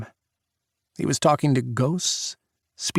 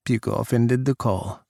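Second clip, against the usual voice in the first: ordinary delivery, nothing marked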